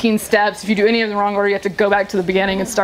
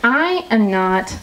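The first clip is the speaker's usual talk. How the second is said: imitating NPR reporter; slower than usual. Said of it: "She goes".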